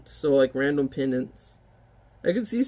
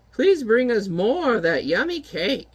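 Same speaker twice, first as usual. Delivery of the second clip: witch voice; 6 semitones higher than usual. Acted. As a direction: savour the stench like a cackling witch. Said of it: "witches ask for cake".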